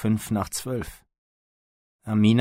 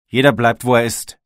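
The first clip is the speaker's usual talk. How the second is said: loud, high shout to the group; forceful, raised-pitch order; called-out order; 4 semitones higher, 12 dB louder than usual. A narrator's reading. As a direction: shout it round as a command. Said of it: "rief ich in die Runde".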